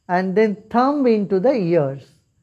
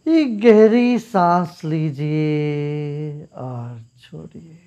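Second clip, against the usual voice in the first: guided meditation; 3 semitones lower than usual. Acted. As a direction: guide them gently into calm. Leads a meditation.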